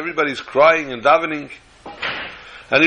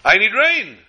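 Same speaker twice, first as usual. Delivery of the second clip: crying out to heaven; 6 semitones higher than usual. Retold or imitated. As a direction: call up to God from the field. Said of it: "I cry out to our Father in heaven".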